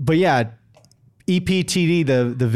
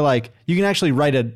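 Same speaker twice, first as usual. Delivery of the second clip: ordinary talk, nothing marked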